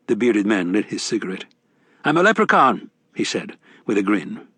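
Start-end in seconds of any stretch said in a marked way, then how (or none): 2.1-2.9 higher, brash Irish barfly; rowdy leprechaun. A narrator's reading. Mad Sweeney voiced in narration.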